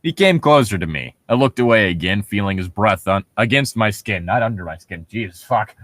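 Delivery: ordinary talk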